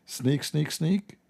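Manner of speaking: sing-song sneaking off; sneaky tiptoe. Retold or imitated.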